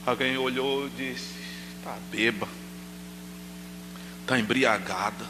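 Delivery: low, soft murmur; hushed telling. Retold or imitated. Preacher retelling an onlooker's judgment of Hannah, low hushed voice. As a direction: tell it under your breath.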